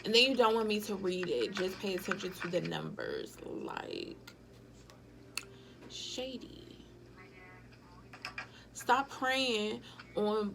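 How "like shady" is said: hushed, whispered shady; whispered verdict. Real, not acted. Viewer judging the shady contract, whisper. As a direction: whisper it like a secret judgment.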